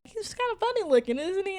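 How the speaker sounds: put-on high, quick little girl voice; squeaky, delighted kid voice; high girly voice. Cousin voiced in retold scene.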